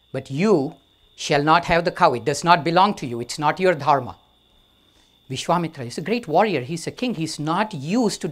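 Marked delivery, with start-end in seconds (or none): 0.1-2.1 louder, firm refusal with disdain; firm and disdainful. Acted. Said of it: "disdainful and firm". 2.1-4.2 louder, firm disdainful verdict. Acted. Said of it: "disdainful and firm".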